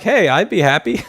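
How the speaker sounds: loud, high, breaking into laughter; laughing retelling. Retold or imitated. Retelling the hostess's reply, laughing loudly. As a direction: crack up while quoting her.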